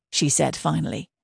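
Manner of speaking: ordinary delivery, nothing marked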